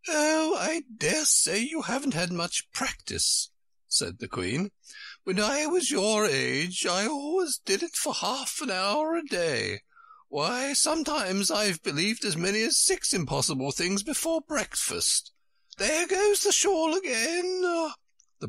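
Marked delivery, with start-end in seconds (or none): 0.1-3.5 very high, fluting Queen voice; high-pitched dotty Queen. A narrator's reading. 5.2-17.6 high-pitched Queen, rising to an outcry. A narrator's reading.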